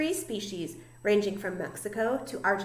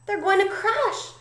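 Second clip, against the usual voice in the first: high, urgent warning; gasped alarm; 10 semitones higher, 4 dB louder than usual. A narrator's reading. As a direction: raise the pitch in storybook alarm. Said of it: "gasped Stella Luna".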